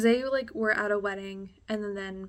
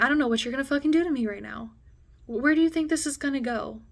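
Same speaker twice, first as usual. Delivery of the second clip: loud, fast frightened yelling; frantic, raised voice, higher; panicked shout; 4 semitones higher, 7 dB louder than usual. Retold or imitated. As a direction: scream at him to get out. Panicked, blocked at the door.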